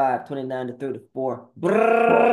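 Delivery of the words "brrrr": high loud lip buzz brrr; lip trill. Acted. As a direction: blow a loud shivery brrr. Lip trill after scripture citation.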